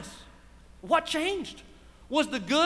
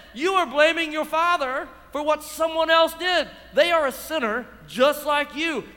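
ordinary talk

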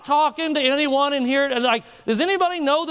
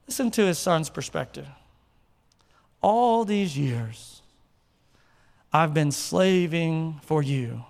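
solemn scripture reading; 7 semitones lower than usual. Acted. Pastor reading the brother's complaint, low solemn reading. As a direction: read it gravely, letting lines sink.

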